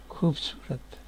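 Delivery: normal talking voice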